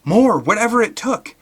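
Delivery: high, loud desperate plea; pleading. Acted. Billy pleading his case to Alan, high and loud. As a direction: beg him to understand.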